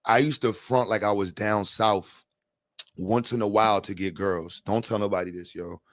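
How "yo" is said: normal talking voice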